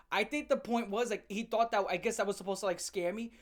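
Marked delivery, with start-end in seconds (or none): none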